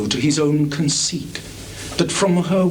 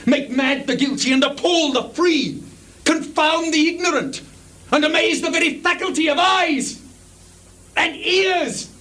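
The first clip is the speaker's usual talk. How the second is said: building rant; 10 semitones higher than usual. Acted.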